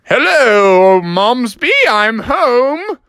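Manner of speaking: hearty acted homecoming call; calling out on arrival. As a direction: holler it through the house as dad. Dad calls out arriving home.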